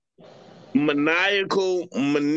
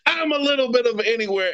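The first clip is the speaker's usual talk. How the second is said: laughing; 6 semitones higher, faster than usual. Real, not acted. Laughing at own joke.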